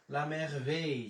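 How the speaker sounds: slow, careful reading; drawn out, word by word; slow French recap; slow demo. Shown as a demonstration. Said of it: "দেখেন আমাদের উচ্চারণ".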